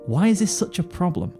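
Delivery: usual speaking voice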